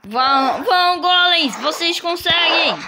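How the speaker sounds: loud, high cheering; root for the team. Real, not acted. Cheering golems in battle. (torcendo animado)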